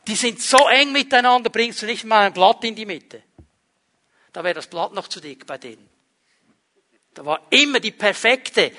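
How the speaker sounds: ordinary delivery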